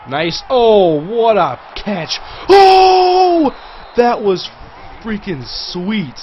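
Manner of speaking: real thrilled yell, high and loud; excited shout. Real, not acted. Gamer reacts to his one-handed catch, excited shout. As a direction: burst out whooping over an amazing catch. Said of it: "I get really excited".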